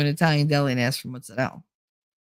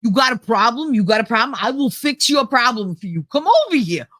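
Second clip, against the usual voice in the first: mock Brooklyn accent; 4 semitones higher than usual. Mocking TV's idea of Jersey, exaggerated Brooklyn accent. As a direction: do a pushy Brooklyn caricature. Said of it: "That's Brooklyn".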